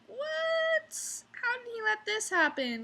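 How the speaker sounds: a sharp high squeak of disbelief; retold disbelief. Waitress recalling her shock at the coworker, high baffled squeak. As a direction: gasp it out, baffled and appalled. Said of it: "And I'm just like".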